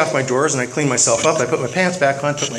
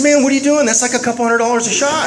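shocked friend; 8 semitones higher, 3 dB louder than usual. Retold or imitated. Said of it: "he said".

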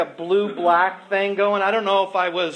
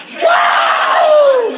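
very high, loud stage whoop; punk whoop; 8 dB louder than usual. Acted. Frontman whooping at the crowd, wild loud whoop. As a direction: let out a wild rock-show whoop.

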